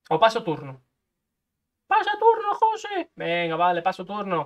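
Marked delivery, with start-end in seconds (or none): 1.9-3.1 high, pushy inner voice telling him to pass; a bossy little voice in his head; inner voice urging. Acted. Gamer voices his inner voice bossing himself. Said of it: "¿Pero por qué me lo has dicho?".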